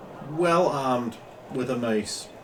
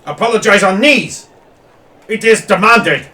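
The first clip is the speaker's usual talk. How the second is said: loud, high shouted command in broken speech; angry demand; 9 semitones higher, 14 dB louder than usual. Acted. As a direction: order him to his knees. Noble demanding an apology.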